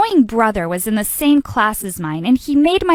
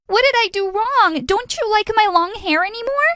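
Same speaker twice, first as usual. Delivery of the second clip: high-pitched, half-shouted cry of betrayal; screaming in shock; 10 semitones higher than usual. Acted. Girlfriend catching her boyfriend with her brother, shrieking. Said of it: "barged into the room screaming".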